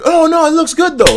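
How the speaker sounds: very high, airy mock barber; dismissive barber. Barber insisting a bad cut looks fine, high and airy. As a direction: shrug it off in a lifted voice.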